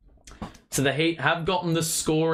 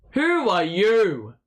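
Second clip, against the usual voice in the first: taunting who are you; 5 semitones higher, 4 dB louder, slower than usual. Retold or imitated. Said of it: "And he's like".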